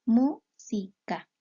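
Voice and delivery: "mu-si-ca" said very slowly, each syllable apart; split into syllables. Shown as a demonstration. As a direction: count the syllables out loud slowly. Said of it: "el conteo silábico".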